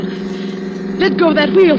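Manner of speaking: desperate shout. Acted. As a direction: scream at him to let go of the wheel. Struggling over the wheel, repeated.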